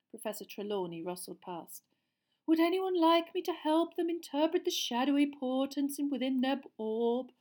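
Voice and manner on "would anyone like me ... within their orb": higher, lilting seer murmur; murmured offer. A narrator's reading. Teacher murmuring offer of help to pupils. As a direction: coax the class like a dreamy mystic. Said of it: "she murmured".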